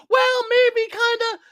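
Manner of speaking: very high, wishy-washy mock hedging; mock pretending. Co-host mocks people hedging about America first, high-pitched pretence. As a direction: squeak it out like a feigned waverer. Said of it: "We're not gonna pretend like".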